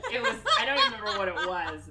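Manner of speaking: rushed, laughing admission; fast embarrassed ramble, loudly laughing. Real, not acted. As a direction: blurt it out quickly while laughing.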